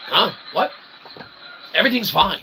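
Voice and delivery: ordinary talk, nothing marked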